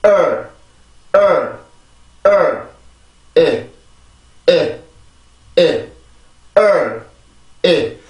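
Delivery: strong first syllable, weak second; drilled "early" syllables. Shown as a demonstration. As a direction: stress on 을, light 에. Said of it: "이 발음 해봅시다".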